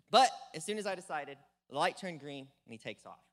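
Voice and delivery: ordinary talk, nothing marked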